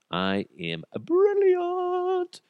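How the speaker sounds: high, slow, put-on boast; a pompous hammy voice; mock grandiose. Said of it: "I should be a sales rep".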